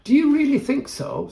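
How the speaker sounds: high, light princess voice; a hint of a girlish princess; cartoon princess. A narrator's reading. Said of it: "said a voice".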